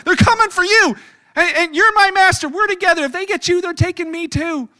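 high, loud frantic pleading; hammed-up terrified servant begging; panicked servant. Servant begs Elisha as troops surround town, high frantic plea. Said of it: "He's like".

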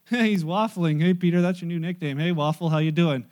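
rough buddies mocking a friend; teasing fishermen. Those fishermen were like.

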